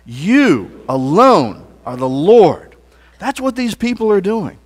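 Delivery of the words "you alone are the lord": slow and louder, proclaiming it; louder conviction. Shown as a demonstration. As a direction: call it out to the room, slowly.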